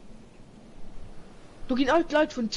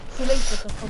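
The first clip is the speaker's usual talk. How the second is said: louder urgent call-out; panicking gamer; 6 dB louder than usual. Real, not acted. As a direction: shout it across the fight. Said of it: "repeated louder as an urgent call".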